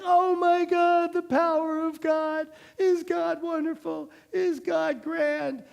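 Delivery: high, loud hammed-up praise; mock rapture. Israelites praising God after the sea closes, mock rapture. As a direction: gush over God like an overexcited crowd.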